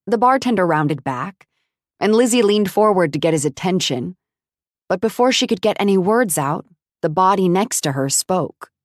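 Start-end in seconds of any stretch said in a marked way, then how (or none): none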